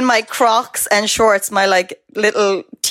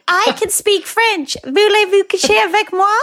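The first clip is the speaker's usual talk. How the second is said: put-on proud little kid; 13 semitones higher than usual. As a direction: pipe it up like a seven-year-old proud of her French. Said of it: "being like".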